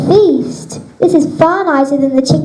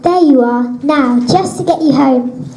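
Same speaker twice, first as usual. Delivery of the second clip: ordinary talk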